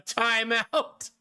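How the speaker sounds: ordinary talk, nothing marked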